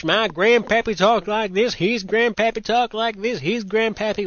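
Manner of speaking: high singsong mock American accent; hammed-up American twang, easing off by the end. Host imitating Americans tracing their grandpappies, exaggerated American accent. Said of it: "these are the American boys and girls".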